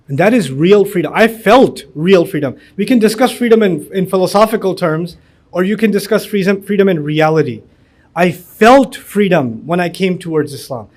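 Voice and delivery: very slow, deliberate English; solemn testimony. Acted. Speaker telling the audience about finding freedom, very slow and solemn. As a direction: weigh each word like a confession.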